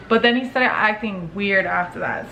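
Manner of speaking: usual speaking voice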